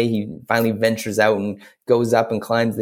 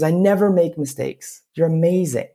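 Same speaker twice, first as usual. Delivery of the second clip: higher-pitched encouraging storybook voice; wood carver; 7 semitones higher than usual. Acted. Wood carver reassures Punchinello of his worth, high encouraging voice. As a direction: build him up in a raised voice. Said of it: "He goes".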